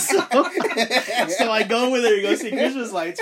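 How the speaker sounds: loud laugh breaking into the words. Real, not acted. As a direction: talk through a big laugh. Laughing at own story.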